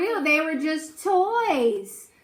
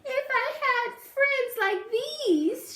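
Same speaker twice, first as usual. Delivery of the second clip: high, sighing storybook voice; a wistful sigh of a line; sighing Miss Spider; 10 semitones higher than usual. A narrator's reading. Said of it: "she sighed".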